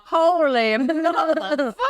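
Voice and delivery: high, breaking into a laugh mid-curse; shocked curse collapsing into laughter; laughing mock shock. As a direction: blurt it out while cracking up. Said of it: "be like".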